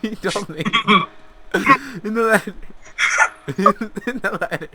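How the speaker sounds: high, laughing and breaking up. Real, not acted. [name] retelling the letter while laughing, high and giggly. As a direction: try to talk through helpless giggles.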